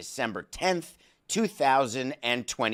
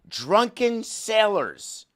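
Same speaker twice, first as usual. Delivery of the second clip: high, slow drawn-out announcement of the phrase; slow word of the day; 9 semitones higher, 4 dB louder, much slower than usual. Shown as a demonstration. Host announcing the word of the day, slow and high. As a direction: stretch out Drunken sailors like a title card. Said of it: "word of the day".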